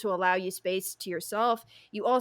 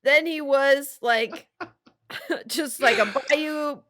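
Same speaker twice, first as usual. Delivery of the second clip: laughing; 5 semitones higher, 4 dB louder than usual. Real, not acted. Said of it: "laugh cry".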